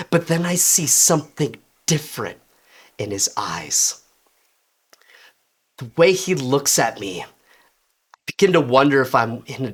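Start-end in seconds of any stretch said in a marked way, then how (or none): none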